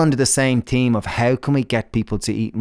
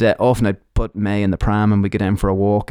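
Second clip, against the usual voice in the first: ordinary talk, nothing marked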